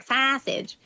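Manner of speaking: Southern twang slipping out on one word; Southern-accented slip. Shown as a demonstration. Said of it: "I'll have an accent".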